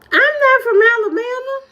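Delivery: high, outraged denial; high indignant protest. Real, not acted. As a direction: squeal it, offended.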